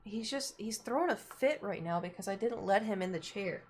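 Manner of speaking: ordinary talk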